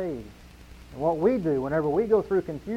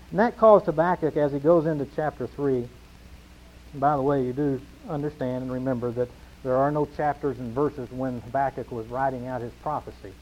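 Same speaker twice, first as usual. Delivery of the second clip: ordinary talk, nothing marked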